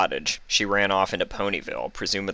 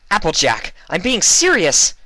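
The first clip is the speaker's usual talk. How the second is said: much higher, louder furious shout; angry bark; 13 semitones higher, 9 dB louder than usual. A narrator's reading. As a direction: snap at her to be serious. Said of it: "Twilight barked angrily".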